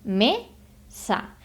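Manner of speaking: "Me, sa" as two separate syllables with a break; "Me, sa" clearly split into two beats; syllables split apart. Shown as a demonstration. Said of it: "separarlo en sílabas".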